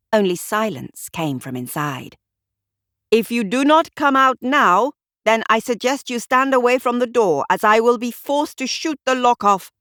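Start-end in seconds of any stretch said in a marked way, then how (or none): 3.1-9.7 higher, louder stern warning. A narrator's reading. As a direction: threaten through the door, firm. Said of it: "warned Amarnath".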